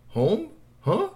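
high, questioning squeak of confusion; puzzled alien. A narrator's reading. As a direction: ask it baffled, voice jumping up. Puzzled alien hears "home.

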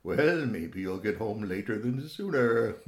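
usual speaking voice

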